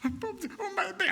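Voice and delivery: very high mock weeping; imitated tearful mother. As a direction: wail it like an overcome mother. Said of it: "And she started to cry".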